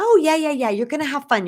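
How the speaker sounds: high, loud mock mom, breezy and dismissive; hammed-up cheery mom brushing a child off. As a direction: imitate mom waving the worry away, pitched high and loud. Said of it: "She said".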